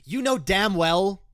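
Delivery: so sick of this argument; furious rant. Real, not acted. Host rants at right-wing critics, a loud furious rant. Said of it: "I am so sick and fucking tired".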